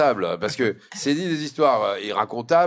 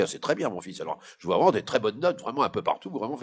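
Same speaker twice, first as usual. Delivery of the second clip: fatherly praise; 6 semitones lower, 5 dB quieter than usual. Acted. Father reads report card.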